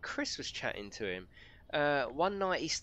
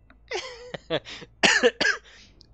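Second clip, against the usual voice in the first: loud, high burst of laughter; real laughter; 11 dB louder than usual. Real, not acted. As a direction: laugh out loud at your own plea. Laughs after urging viewers to click.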